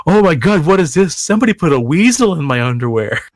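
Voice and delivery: over-the-top pretend startle; mock alarm. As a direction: fake panic at a weasel in his shorts. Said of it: "acting out weasel prank".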